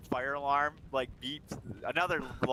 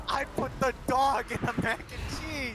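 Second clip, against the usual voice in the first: higher, slow, plain-faced admission; matter-of-fact confession; 11 semitones higher, slower than usual. Retold or imitated. Character admits the dog ingredient, slow high deadpan. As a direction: say it slowly like it's nothing. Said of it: "He's like".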